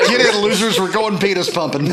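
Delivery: loud, high, laughing callout; gleeful shout. Pretend. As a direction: yell it like a joyride invite. Shouted movie quote joke.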